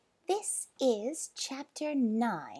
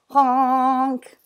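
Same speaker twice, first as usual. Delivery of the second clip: long, loud, raised honk; monkey honking; 5 semitones higher, 13 dB louder than usual. Acted. Proboscis monkey honking once more, hooter sound. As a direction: honk it like a horn. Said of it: "honking his hooter".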